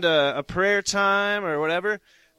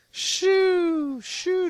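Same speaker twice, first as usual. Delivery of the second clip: ecstatic high shoo; 12 semitones higher than usual. Real, not acted. Sending a zap from the Lord.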